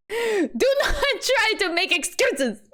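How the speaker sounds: put-on slow, high, overdone Russian accent; attempted Russian accent. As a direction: scold emphatically in a drawn-out Russian accent. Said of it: "I'm not very good at Russian accents".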